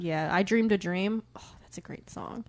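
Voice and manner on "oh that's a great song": hushed whisper of admiration; whispered fan reverence; reverent whisper. Real, not acted. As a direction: breathe the song title in awe. Reverent about a favorite song.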